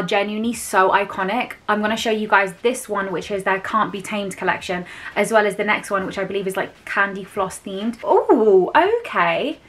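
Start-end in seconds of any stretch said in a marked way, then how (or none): none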